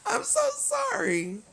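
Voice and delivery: very high, slow, drawn-out sorry; pleading apology. Real, not acted. Streamer begging Journey's forgiveness, very high and slow. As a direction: stretch it up into a begging whine.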